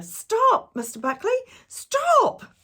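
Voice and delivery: very high, loud desperate shout; shouting stop. Acted. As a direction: yell to stop him now. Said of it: "all cried out together".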